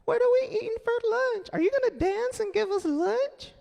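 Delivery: very high, squealing excited women; excited ladies. Retold or imitated. Shelter women greeting [name], squealing with excitement. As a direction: squeal like starstruck fans. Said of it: "All the ladies be happy and say".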